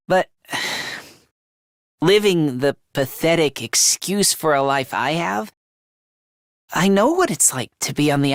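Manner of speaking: low, subdued confession; sombre reflection. Acted. Fighter doubting the killing of a prisoner, sombre. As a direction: drop low and speak haltingly.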